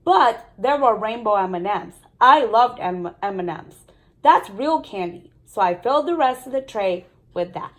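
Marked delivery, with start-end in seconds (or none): none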